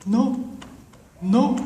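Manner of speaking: high little imitated denial; evasive beggar. Beggar not even checking his pockets, feigned innocence. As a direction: deny it in a high, innocent put-on voice. Playing beggar denying he has it.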